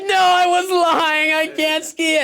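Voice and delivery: put-on high, slow, loud wailing child; whiny kid voice. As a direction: wail it like a stubborn kid.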